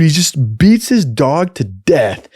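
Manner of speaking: ordinary delivery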